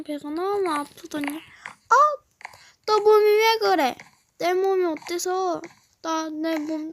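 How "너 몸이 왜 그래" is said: 높고 크게 놀란 엄마; 아이 몸을 보고 깜짝 놀라 외치듯; alarmed mom. Acted. 엄마가 놀라 외침. (깜짝 놀란 엄마 목소리)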